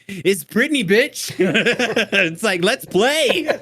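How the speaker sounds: high gleeful party voice; gleeful party mode. Retold or imitated. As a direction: let loose, party time. Mom free once kids sleep.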